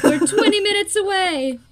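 high, laughing, loud; laughing mock quote. Co-host voices agents, laughing.

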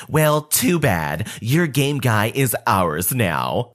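slow, put-on scornful mom voice; annoyed Karen. Karen brushing off the boy she stole from, slow put-on scorn. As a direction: let her sneer at the kid, slowly. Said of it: "She said".

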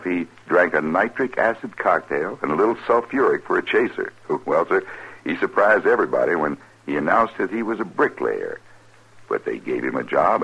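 ordinary talk, nothing marked

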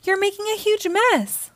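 high-pitched complaining wail. A narrator's reading. Dad chasing his son through bubbles, wailing. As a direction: protest in a high voice, without shouting. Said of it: "wailed Dad".

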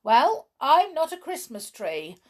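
high, loud indignant retort; shouting back. A narrator's reading. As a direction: call it back across the house. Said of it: "shouted back Joanna".

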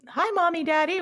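put-on high, sweet little kid greeting; kid voices. Twins greeting their new parents, high child voice. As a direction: chirp it like a five-year-old.

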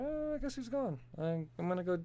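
a put-upon hero giving up; resigned sigh. Retold or imitated. Superman shrugging off his son's exit, soft and deflated.

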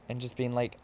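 usual speaking voice

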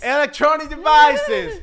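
imitating security officer. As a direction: stretch the words and bellow them like a caricature guard. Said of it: "cuando me empiezan a hablar así". (imitación exagerada del guardia, lenta y aguda)

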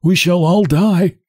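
high, slow cracked voice; resigned hunchback, drawn out; with a sigh. A narrator's reading. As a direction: dismiss the news in a high, sighing voice. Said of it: "says the hunchback with a sigh".

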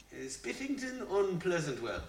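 slow, high, soft attempt at an English accent. Shown as a demonstration. Singer trying an accent on request, tentative English accent. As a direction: hesitantly put on a mock-English accent. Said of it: "weirdly was your best English accent".

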